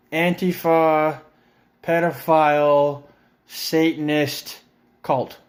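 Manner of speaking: each word spaced out and dragged, "Antifa", "pedophile", "Satanist" drawn out; slow mocking list. Real, not acted. Ranting vlogger mocking QAnon labels word by word, slow and deliberate. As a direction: spell out the absurd labels one by one.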